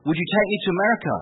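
high-pitched imitation of a pleading nurse; eager nurse's voice. Nurse asking patient for passage, eager imitation.

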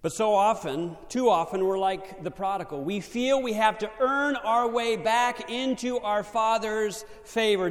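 loud, high-pitched preaching. Acted. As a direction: preach it out with fire. Preaching at full force.

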